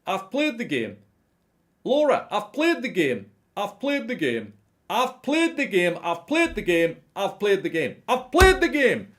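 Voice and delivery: high, loud mocking rant, stress on played; mock a pundit ranting into his mic; ranting Souness impression. Vlogger mocking a ranting pundit, high loud rant. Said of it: "he's just going".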